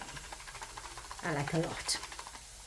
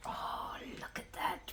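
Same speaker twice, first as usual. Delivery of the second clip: whispering; 13 dB quieter, slower than usual. Real, not acted. Awed by fresh bread.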